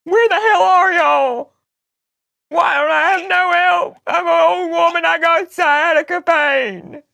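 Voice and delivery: high, wailing complaint with stretched words; mock-tearful tirade. Prank caller complaining to a stranger about no help, crying. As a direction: sob and rail in a put-on breakdown.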